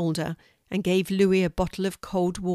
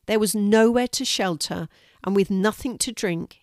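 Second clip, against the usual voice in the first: ordinary delivery, nothing marked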